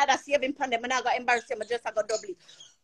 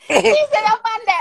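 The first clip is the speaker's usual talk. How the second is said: higher, louder, laughing through the words; laughing repeat; 6 semitones higher, 4 dB louder than usual. Real, not acted. Repeating her joke to the chat, laughing. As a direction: giggle the line out a second time.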